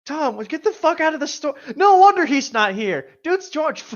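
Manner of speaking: high, loud mock yell at Tom; loud joking yell at a character. Real, not acted.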